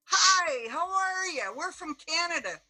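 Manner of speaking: high, loud, bright hello to break the silence; mock-chirpy tourist calling out; overly cheerful greeting. Greeting staring diners loudly.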